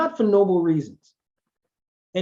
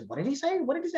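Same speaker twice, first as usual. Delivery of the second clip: much higher, softer puzzled repeated question; mock confused listener; 10 semitones higher, 6 dB quieter, much faster than usual. Listener failing to understand the presenter, high confused mutter. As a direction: play a baffled audience member. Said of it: "nobody says".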